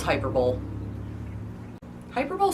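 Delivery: hyperbole as hyper-bowl; hyperbole with a silent final e; misreading. Shown as a demonstration. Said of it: "But no".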